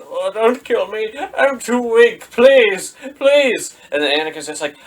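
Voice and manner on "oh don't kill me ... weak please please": much higher, louder feeble begging; whimpering Palpatine. Retold or imitated. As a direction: beg for your life like a frail old man. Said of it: "Palpatine's just on the ground".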